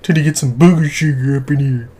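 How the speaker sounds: lightly put-on slangy voice, mimicking a friend; mocking imitation. Teasing a friend over Candyman, mocking slangy imitation. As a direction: tease him by copying his slang.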